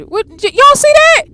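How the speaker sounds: high loud "y'all see that?"; alarmed, call out to chat; spooked disbelief. Real, not acted. Spooked player asks chat.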